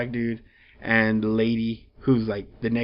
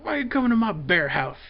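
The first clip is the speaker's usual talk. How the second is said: lightly voiced Bear Fighter, higher pitched; a hint of a territorial hermit asking why; gruff hero voice; 9 semitones higher than usual. Bear Fighter confronts intruders at his bear house, mock indignant. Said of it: "he was like".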